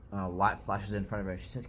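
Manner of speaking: ordinary talk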